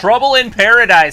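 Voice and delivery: high, loud announcer call; excited commentary. Real, not acted. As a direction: proclaim the drama to the crowd. Live call of wrestling drama.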